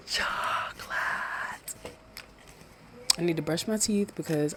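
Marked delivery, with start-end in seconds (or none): 0.1-1.7 slow, drawn-out unvoiced whisper; whispered cartoon quote. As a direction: breathe the word like a craving cartoon guy. Repeats the SpongeBob quote.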